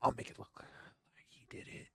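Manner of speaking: hushed scheming whisper; villain whispering a plan; sinister whisper. Acted. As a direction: whisper it like plotting a frame-up. Voicing the killer's scheming thought.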